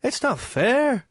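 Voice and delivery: put-on Irish accent. Teasing the host about women and accents, in a mock Irish accent. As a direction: lightly put on an Irish lilt, slowly. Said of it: "Did you just do an Irish accent?".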